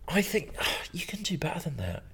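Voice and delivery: soft, high near-whisper; gently whispered reproach; hushed. Real, not acted. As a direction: breathe it out in a high hush. Pleading aside about a poor moustache.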